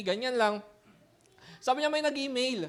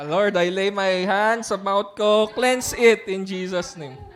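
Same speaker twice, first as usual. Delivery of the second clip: slow, louder, solemn mock prayer; 2 semitones lower, 5 dB louder, slower than usual. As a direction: pray it slowly, half joking. Mock prayer to cleanse mouth. (mabagal na kunwaring panalangin)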